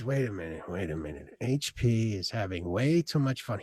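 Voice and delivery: very low, hushed divine voice; God. Acted. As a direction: intervene softly from deep down. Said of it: "God says".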